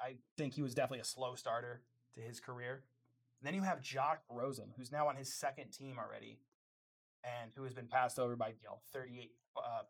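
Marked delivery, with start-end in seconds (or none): none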